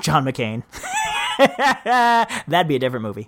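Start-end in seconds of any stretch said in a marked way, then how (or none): 0.7-2.4 high burst of laughter; real laugh. Real, not acted. Host amused by his own slip about a movie, laughing.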